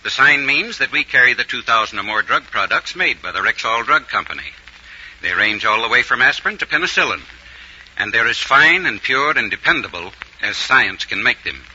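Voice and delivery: ordinary talk, nothing marked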